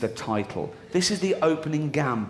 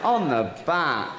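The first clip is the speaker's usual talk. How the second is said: slow insistent correction; slower than usual. Retold or imitated. Correcting partner slowly.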